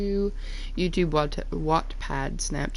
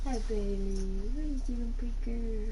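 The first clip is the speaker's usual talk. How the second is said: soft cooing to the dog; pet talk; 3 semitones higher, 5 dB quieter than usual. Real, not acted. Owner softly cooing to her dog Bailey.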